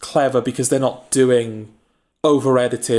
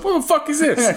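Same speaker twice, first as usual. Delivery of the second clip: put-on Italian American accent; 14 semitones higher than usual. Joke Mario meets Bowser.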